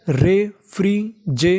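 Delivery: Refrigerator drawn out slowly, each syllable apart. Shown as a demonstration. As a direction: sound out refrigerator slowly for learners. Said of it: "पूरी meaning मिला के पढ़ते हैं". (Refrigerator धीरे-धीरे, एक-एक अक्षर अलग करके)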